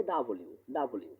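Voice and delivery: ডাবল ইউ in full, ending "yu"; correct W. Shown as a demonstration. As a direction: say ডাবল ইউ as double-U, every part sounded. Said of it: "এটা উচ্চারণ কি হবে?".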